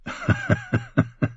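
a brief burst of laughter; short laugh. Acted. James laughing at Lancel's wish to be like him. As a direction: laugh at the cousin's confession. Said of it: "James musste lachen".

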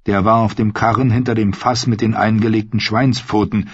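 usual speaking voice

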